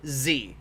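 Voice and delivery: "Z" with a hard z for "the"; exaggerated French Z. Shown as a demonstration. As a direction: overdo the z in "Z". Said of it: "don't actually say".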